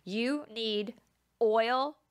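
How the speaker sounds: usual speaking voice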